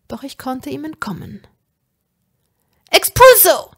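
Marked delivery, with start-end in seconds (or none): 2.9-3.7 high, full-throated spell shout; yelled curse, much higher and louder. A narrator's reading. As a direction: hurl the spell out at the top of your voice. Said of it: "schrie ich".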